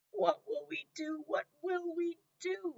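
wailing. A narrator's reading. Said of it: "wailed Cook".

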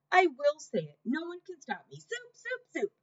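Cook insists she'll say it; defiant shout. A narrator's reading. Cook defying Louise, a high defiant shout. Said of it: "shouted Cook".

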